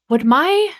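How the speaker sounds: high, loud, exasperated outburst; annoyed. Real, not acted. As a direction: snap at a misbehaving gadget, half laughing. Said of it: "I am so annoyed right now".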